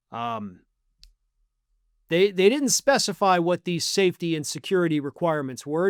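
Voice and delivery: usual speaking voice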